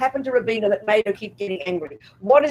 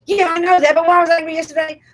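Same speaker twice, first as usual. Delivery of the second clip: high, loud, mock exasperation; impatient retort; 8 semitones higher, 7 dB louder, faster than usual. Student arguing back at the Buddha, acted impatience. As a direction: push back impatiently, pitched up and insistent.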